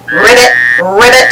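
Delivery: low croaking ribbit; low frog ribbits. A narrator's reading. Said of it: "It said".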